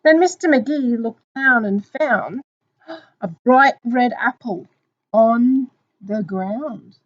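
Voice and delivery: loud, drawn-out picture-book reading; slow down and build suspense for children; story narration. Acted. Teacher reading of the apple's discovery, slow story narration.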